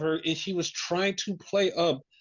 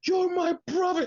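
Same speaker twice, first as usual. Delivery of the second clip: Trey Miguel's mock tearful yell; 13 semitones higher than usual. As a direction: imitate an anguished yell in a high voice. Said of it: "he just yells at Wesley".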